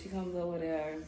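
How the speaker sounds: usual speaking voice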